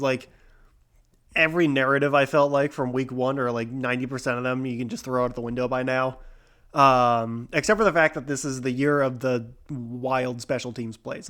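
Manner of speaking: ordinary delivery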